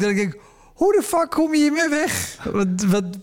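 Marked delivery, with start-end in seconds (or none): 0.7-2.4 amazed disbelief. Retold or imitated. Incredulous inner thought.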